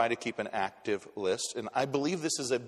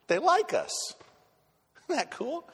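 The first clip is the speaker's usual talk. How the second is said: mock delight; 3 dB louder than usual. Delighted aside to congregation.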